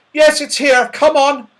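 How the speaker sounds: high, loud call downwards; excited high shout down to the others. A narrator's reading. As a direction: call down the ladder to friends below. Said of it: "called down to the others".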